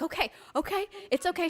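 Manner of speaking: mom soothing. Retold or imitated. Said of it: "she's like".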